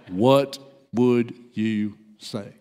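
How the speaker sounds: low, slow, one word at a time; slow insistence. Retold or imitated. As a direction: press the question with a pause after each word.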